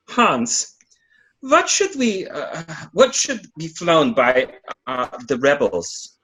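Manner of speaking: put-on German accent, hammed up; a peasant rebel asks in a mock German accent. Rebel peasant asks his friend about a flag, in a hammed-up German accent. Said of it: "I need to work on my German accent".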